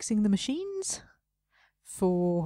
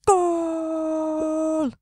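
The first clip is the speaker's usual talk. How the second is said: long, loud, higher commentator "Goal!"; football commentator goal; 7 semitones higher, 8 dB louder than usual. Pretend. Celebrating a game score, a loud mock commentator yell. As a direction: belt it like a stadium announcer.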